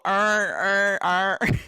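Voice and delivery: Baltimore accent, iron said "arn". Shown as a demonstration. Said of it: "say it like the Baltimore people".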